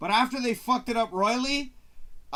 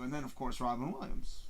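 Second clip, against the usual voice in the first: normal talking voice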